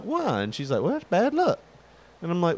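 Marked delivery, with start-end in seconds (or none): 0.0-0.4 put-on childlike why. Kid asking grandmother. 0.8-1.6 grandma voice. Retold or imitated.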